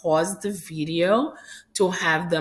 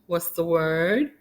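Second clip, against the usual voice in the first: ordinary talk, nothing marked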